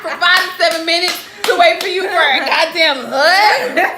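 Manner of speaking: laughing disbelief. Real, not acted. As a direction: scoff at it through laughter. Friend mocks the request, laughing.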